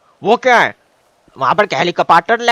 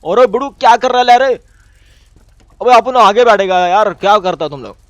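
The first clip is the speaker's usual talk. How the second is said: loud, pitched-up calls; shouting over the ride; 5 semitones higher, 6 dB louder than usual. Real, not acted. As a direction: shout to be heard in the car. Loud banter during ride. (ज़ोर से चिल्लाकर बात करना)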